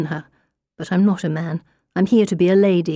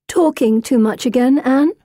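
husky voice; 6 semitones higher than usual. A narrator's reading. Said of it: "a husky voice cuts her off".

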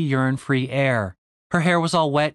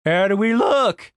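lightly muffled, higher-pitched question; a touch of talking under robes; 6 semitones higher, 4 dB louder than usual. Acted. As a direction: ask as if through cloth, pitch lifted. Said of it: "her voice muffled by the robes".

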